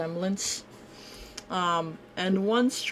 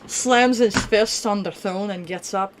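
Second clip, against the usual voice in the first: ordinary talk, nothing marked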